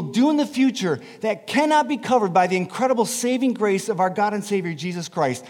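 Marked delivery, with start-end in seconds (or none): none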